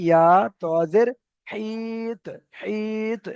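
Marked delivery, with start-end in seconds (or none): 1.5-3.2 হিত with the i held long; drawn-out madd. Shown as a demonstration. Teacher joins the letters, long-drawn হিত with bounced t. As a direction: stretch the i of হিত, then release the t with an echo. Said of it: "এখানে টান কেন হচ্ছে?".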